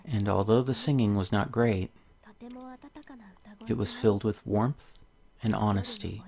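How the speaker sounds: soft, gentle storybook reading; story narration. Acted. Reading a tale to viewers, soft storybook voice. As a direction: read the fairy tale softly to the viewers. Reads the game's story aloud.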